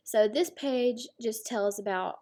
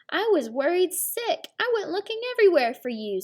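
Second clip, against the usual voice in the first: high, fast, breathless relief; worried mother; 8 semitones higher, 4 dB louder than usual. Acted. Mama Hyena to her returned daughter, high and fast.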